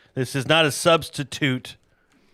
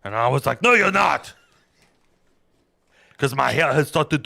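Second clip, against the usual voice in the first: loud, higher Arnold impression telling the story; Arnold Schwarzenegger voice; 4 semitones higher, 5 dB louder than usual. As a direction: tell Samson like Arnold, booming. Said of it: "as I was doing the Arnold".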